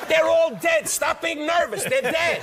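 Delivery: loud, high mock exasperation; mock yell. Real, not acted. Comedian yelling at the nervous host, hammed-up loud. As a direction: snap at him to stop fussing, hammed up. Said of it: "mock yell at nervous host".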